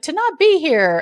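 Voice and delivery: high, loud, laughing comeback; retold blunt retort. Olivia answering Ben's puppet, comically blunt. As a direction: playfully imitate a too-literal answer.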